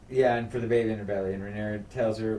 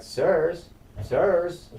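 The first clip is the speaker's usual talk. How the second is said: higher, louder retold protest; mock alarmed call; 8 semitones higher, 7 dB louder than usual. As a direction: call out as if confronting intruders. Startled lady confronts bursting soldiers.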